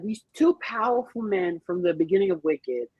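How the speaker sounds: ordinary delivery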